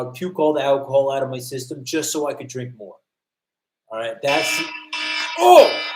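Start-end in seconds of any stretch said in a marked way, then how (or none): 5.4-5.8 sudden high, loud oh; jolted by something, yelp it out; surprised cry. Real, not acted. Interrupting his own story with a sudden loud yelp.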